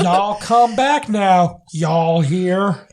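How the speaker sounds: put-on slow, deliberately terrible Southern accent, drawn out; bad Southern accent. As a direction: ham up a fake Southern accent on purpose. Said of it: "the most northern sounding Southern accent".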